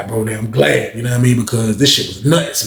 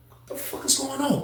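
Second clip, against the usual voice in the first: much higher and much softer, breathless shock; stunned hushed disbelief; 12 semitones higher, 13 dB quieter than usual. Retold or imitated. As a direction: gasp it out in disbelief. Watching the fight.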